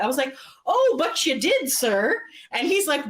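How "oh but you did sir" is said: high, drawn-out retort; mock-indignant comeback. Fan talking back to Jeff, mock-indignant comeback. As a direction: contradict him with playful insistence. Retold comeback contradicting the celebrity.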